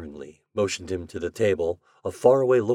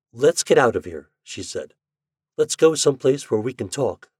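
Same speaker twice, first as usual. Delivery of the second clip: tense with excitement; 6 semitones higher, 5 dB louder than usual. A narrator's reading. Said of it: "her voice was tense with excitement".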